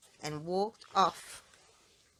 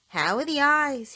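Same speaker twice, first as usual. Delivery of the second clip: cheery doctor; 7 semitones higher, 7 dB louder than usual. Acted. Doctor checking the patient.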